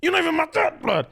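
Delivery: high, indignant teenage mock rant; retold pillow screaming. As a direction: pitch it way up, outraged at stepdad. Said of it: "screaming into my pillow".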